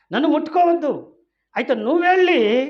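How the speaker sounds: preacher rising to a loud rebuke; fiery preaching. Acted. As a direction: build the rebuke up to a shout. Preacher voicing Jesus emphatically.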